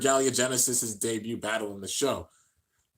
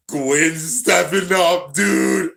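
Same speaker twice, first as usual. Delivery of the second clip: louder, slower, drawn-out hype call; hyped shout; 5 semitones higher, 9 dB louder, much slower than usual. Real, not acted. Gwen steps up to battle, slow hyped shout. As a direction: stretch it out like an excited fan.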